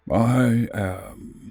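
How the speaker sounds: lightly voiced giant bird, low and cold; deep disdainful voice. Synexian brushing off the small stranger with mild disdain. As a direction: look down on him with a few words. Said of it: "his deep voice filled with disdain".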